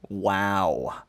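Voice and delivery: usual speaking voice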